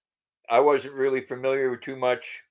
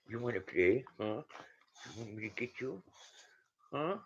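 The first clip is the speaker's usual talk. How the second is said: talking to a dog; 5 dB quieter than usual. Real, not acted. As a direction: coo gently to a sweet dog. Streamer coos softly to her dog.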